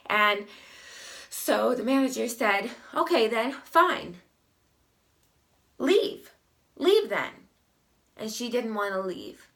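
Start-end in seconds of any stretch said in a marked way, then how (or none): 2.9-7.3 fed-up order. Retold or imitated. Manager ordering customer out.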